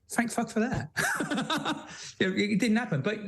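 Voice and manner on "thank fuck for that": high, relieved quip breaking into laughter; laughing relief. Retold or imitated. Prisoner after the mock execution, laughing with relief. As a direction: say it higher, then laugh.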